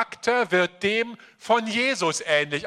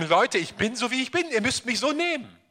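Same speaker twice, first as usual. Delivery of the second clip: stubborn self-excuse; 5 semitones higher, faster than usual. Retold or imitated. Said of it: "sich dem ergeben und sagen".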